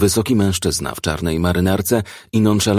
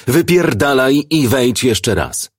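slow, raised, higher-pitched command; 6 semitones higher, 4 dB louder, slower than usual. A narrator's reading. Commissioner ordering a suspect out of his office, raised voice. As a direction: throw him out, drawing the words out. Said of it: "natychmiast podniósł głos".

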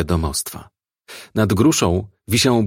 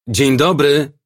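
raised, higher calling voice; called out; 6 semitones higher, 4 dB louder, slower than usual. A narrator's reading. Policeman calling into a house after knocking, called out. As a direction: call through a closed door, moderately loud. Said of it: "krzyknął".

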